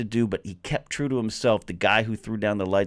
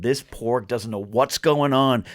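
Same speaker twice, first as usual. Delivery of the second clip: ordinary talk, nothing marked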